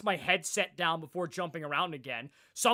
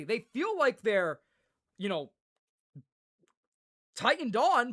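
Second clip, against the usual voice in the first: usual speaking voice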